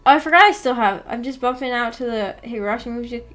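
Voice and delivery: usual speaking voice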